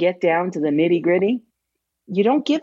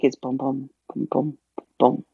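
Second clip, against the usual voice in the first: "boom, boom" softer, beat out evenly; heartbeat rhythm; 5 semitones lower, 4 dB quieter than usual. Shown as a demonstration. Teacher showing students the heartbeat of the iamb, in soft rhythmic booms. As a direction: murmur the "boom" beats like a pulse. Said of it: "to give you the heartbeat".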